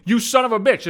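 half-hearted retelling of the boss's scream; mild mock scream. Boss yelling down the phone, lightly mock-screamed. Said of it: "She screamed".

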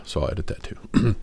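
whispered aside to the editor; murmured note to self about the edit; quiet aside. Real, not acted. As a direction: drop to a whisper, off the record. Aside about editing the recording.

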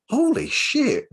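high, slow amazed exclamation; holy shit moment. Retold or imitated. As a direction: stretch it out in disbelief. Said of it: "I'm gonna swear here".

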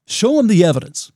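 ordinary talk

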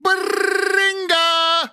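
stretched, high, dramatic "Baringa!"; drawn-out high cry. Acted. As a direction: belt out a long, high greeting call. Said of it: "That was an inspired Baring".

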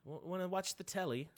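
softly put-on British accent; mock British accent. Teasing mom from England, soft mock British accent. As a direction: imitate an English mom's British phrasing. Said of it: "the words I remember making fun of my mom".

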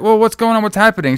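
high-pitched imitation of worried onlookers; mock alarmed questioning. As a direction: retell it as startled bystanders, voice up high. Voices alarmed onlookers in retelling.

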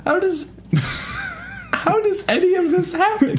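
high, squeaky laughing bafflement; laughing disbelief. Real, not acted. Listener lost in an absurd plot, laughing incredulously. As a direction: barely get the question out through laughter.

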